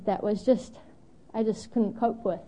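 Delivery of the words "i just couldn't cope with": normal talking voice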